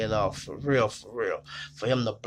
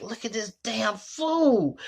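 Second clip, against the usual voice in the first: mocking disbelief; 12 semitones higher, 4 dB louder, slower than usual. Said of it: "They like".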